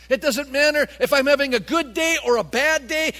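loud, high, driving list; impassioned preaching. Real, not acted. Preacher to church on what's good about rejoicing, impassioned. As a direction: build the case with fervor. Preacher exhorts at full force.

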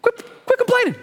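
high barked order; mock command. Preacher imagines ordering the congregation, high mock bark. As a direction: snap it as a cheap fix. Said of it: "for me to just say".

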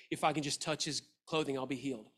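softly repeating the hope to herself; soft and low, said under the breath; woman softly repeating her confession to herself; quiet repeated murmur. Retold or imitated. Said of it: "Just saying it over and over again".

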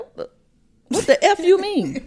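high, shocked mock outrage; indignant retort. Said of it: "I was like".

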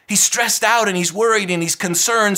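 ordinary talk

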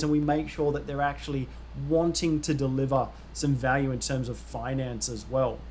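ordinary talk

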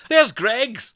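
high, loud excited shout; imitating a delighted local spotting Greggs, high and loud. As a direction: point and yell like you've spotted it. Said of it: "people going".

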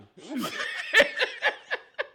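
high, loud laughing fit; laughter. Real, not acted. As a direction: crack up mid-rant. Laughs after calling the coach.

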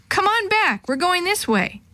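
high-pitched parental call; sing-out call without shouting. A narrator's reading. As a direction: beckon stragglers back, pitched well up. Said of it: "called Mister Vargas".

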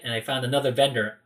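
ordinary talk

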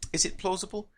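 ordinary delivery

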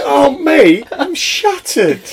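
high, loud wail that slows down; hammed-up plea, drawn out; mock exhausted protest. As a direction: complain as a worn-out sub, pitched right up. Acted player protesting at warming up.

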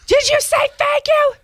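high, loud scolding mom voice; screaming mom. Retold or imitated. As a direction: scold the kids like a shrill mom. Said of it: "I'm like the mom screaming".

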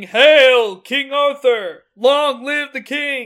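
loud, high, drawn-out acclaim; slow, hammed-up proclaiming shout; mock crowd screaming. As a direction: hail the new king like a shouting crowd. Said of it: "it's just screaming".

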